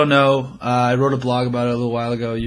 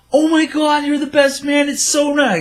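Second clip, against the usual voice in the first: very high, louder gushing squeal; so excited; 4 dB louder than usual. Retold or imitated. Imitating guests meeting the best man, gushing excitedly. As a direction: mimic an overexcited fan in a high voice. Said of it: "so excited".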